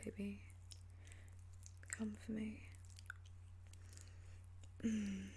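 ordinary delivery

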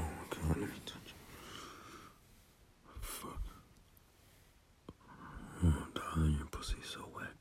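breathy intimate whisper, very soft; sensual whisper. Acted. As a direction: whisper it close to the ear.

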